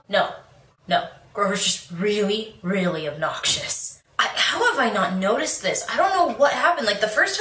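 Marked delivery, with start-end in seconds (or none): none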